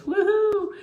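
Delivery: loud, high, drawn-out woohoo; playful cheer. Real, not acted. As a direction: let out a silly high whoop. Cheers after finding book not backwards.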